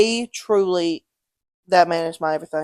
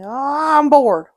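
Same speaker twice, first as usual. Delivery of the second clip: higher, drawn-out complaint; mock bored whine; 6 semitones higher, 4 dB louder, much slower than usual. Dad calling his kid at work, whining with boredom. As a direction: imitate a grown man whining for attention.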